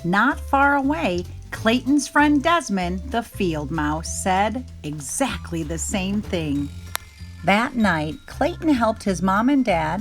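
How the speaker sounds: ordinary delivery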